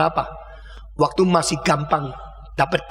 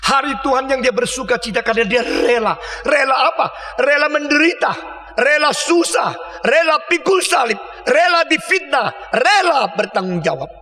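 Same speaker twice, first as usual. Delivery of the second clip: rising preaching cry; 7 semitones higher than usual. Real, not acted. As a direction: build each rela into an impassioned cry. Pengkhotbah membangun seruan rela. (seruan khotbah yang makin keras dan tinggi)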